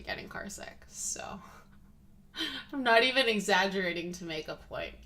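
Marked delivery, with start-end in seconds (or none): 0.0-0.8 breathy whispered aside, I'm actually getting car sick, with no voice. Real, not acted. Laughing aside about car sickness. 0.9-1.4 whisper. Real, not acted. Whispered trailing word.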